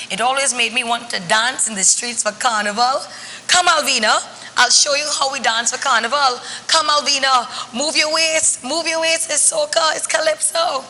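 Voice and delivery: put-on coaxing a child to carnival in a Trinidadian lilt; a playful Trinidadian granny; Granny Vero. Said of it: "I become Granny Vero".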